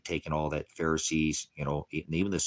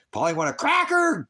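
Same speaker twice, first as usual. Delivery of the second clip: much higher and louder, "cracker" screeched like a parrot; full parrot squawk; 7 dB louder than usual. Pretend. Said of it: "mirroring like a parrot".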